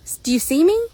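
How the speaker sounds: high voice of a horse wanting notice; puzzled horse. Acted. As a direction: build from a small wait to a loud plea. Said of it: "now that he thinks, he's like".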